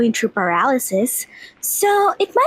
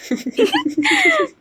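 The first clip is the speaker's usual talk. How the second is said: high giggle. Acted. Girl delighted about her parents.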